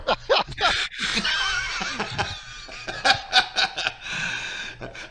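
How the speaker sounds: big laugh breaking into whoops. Real, not acted. Joke about incompetent surgeon.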